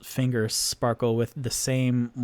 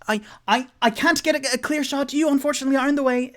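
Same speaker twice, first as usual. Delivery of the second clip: high, louder, flustered voice; hesitant stutter; 13 semitones higher, 7 dB louder, much faster than usual. Acted. Mage tells scout he's blocking the shot, flustered. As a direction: stammer out an apology.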